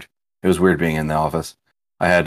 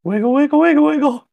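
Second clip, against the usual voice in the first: very high cartoonish little chant; playful squeaky voice. Co-host interrupting talk of the channel, playful squeak. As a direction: chirp it in a tiny high-pitched voice.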